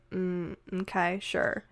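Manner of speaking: imitated soft, slow consent; hesitate, then quietly go along; hesitant agreement. Woman retold agreeing to just dinner, softly hesitant.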